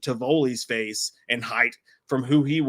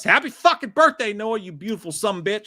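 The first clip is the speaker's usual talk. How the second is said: birthday shout out; 6 semitones higher, 4 dB louder than usual. Real, not acted.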